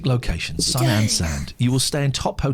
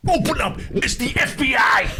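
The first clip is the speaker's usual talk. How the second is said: high-pitched mock FBI shout; hammed-up raid announcement, shouted high; re-enacted police raid; 10 semitones higher, 3 dB louder than usual. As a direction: yell as if bursting through a door. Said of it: "burst in the room and went".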